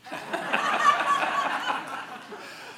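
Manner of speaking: high breathy laugh. Real, not acted. Laughing at own story.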